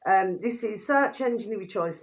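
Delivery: ordinary talk, nothing marked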